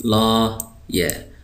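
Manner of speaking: लॉयर drawn out very slowly; slow model word. Shown as a demonstration.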